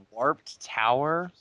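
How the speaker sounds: slow, rising, laughing disbelief; incredulous echo. Acted. Reader echoes the fanfic's Warped Tower in amused disbelief. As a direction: repeat it like you can't believe it. Echoing a silly phrase.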